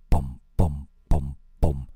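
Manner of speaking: low droning bom bom like a distant pump; hotel guest imitating the pump noise, low and droning; imitated motor thumping. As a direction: mimic a machine thudding through the walls. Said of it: "Wie so ein Motor oder etwas".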